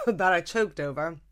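throat still catching after a cough; after choking. Real, not acted. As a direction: talk just after choking. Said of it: "Sip of coffee".

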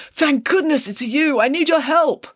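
high, loud, slow and pleading schoolboy; a melodramatic boy begging for help; desperate Parker. Acted. Parker begs through window.

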